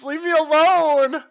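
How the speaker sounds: ordinary talk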